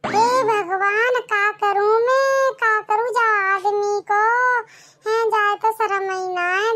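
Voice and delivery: slow, drawn-out wail of exasperation; despairing lament. Acted. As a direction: cry out to God in dramatic dismay. Wife laments over shameless husband.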